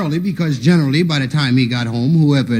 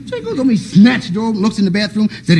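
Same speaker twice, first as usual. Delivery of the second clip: ordinary talk, nothing marked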